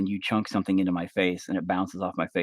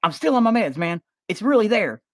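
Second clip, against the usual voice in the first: high put-on voice; high dopey voice; 12 semitones higher, 5 dB louder than usual. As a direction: sound spaced out and sure. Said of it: "I'm like".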